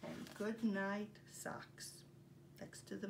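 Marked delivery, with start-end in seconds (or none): none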